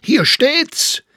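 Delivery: very high, loud outcry; shouting. A narrator's reading. Staufner points at the newspaper, shouting. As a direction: shout it out as proof. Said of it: "schrie Staufner".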